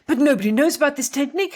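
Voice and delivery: put-on over-the-top French accent, pitched high; terrible French accent. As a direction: exclaim in a badly faked French accent. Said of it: "that was a terrible French accent".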